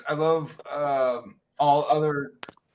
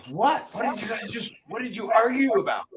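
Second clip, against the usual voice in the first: higher, incredulous retold question; mock astonishment, pitched up; baffled; 5 semitones higher than usual. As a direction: act out being baffled by the argument. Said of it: "I'm like".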